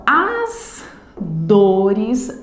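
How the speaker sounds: "as", pause, "dores", very slow with stretched syllables; syllable counting. Shown as a demonstration. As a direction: scan "as dores" beat by beat, drawn out. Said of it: "encerrar a contagem em dores".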